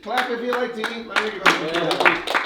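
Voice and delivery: loud call to clap; crowd prompt. Real, not acted.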